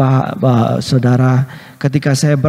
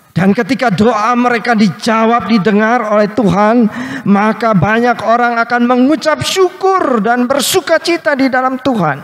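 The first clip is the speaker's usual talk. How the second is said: raised, building sermon voice; fervent preaching; 9 semitones higher than usual. Acted. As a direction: lift the congregation with hope. Preaching at rising intensity.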